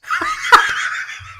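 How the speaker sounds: laughing at his own punchline; high breathy laugh. Real, not acted.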